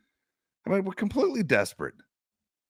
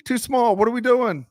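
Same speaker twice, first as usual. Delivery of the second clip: higher, louder, fed-up agreement; mock exasperation; 8 semitones higher, 3 dB louder, slower than usual. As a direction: throw up your hands in disbelief. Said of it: "And I was like".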